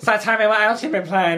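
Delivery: high, nasal, laughing put-on voice; whiny nasal YouTuber voice, half laughing; annoying nasal voice. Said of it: "Maybe I should get a more annoying voice".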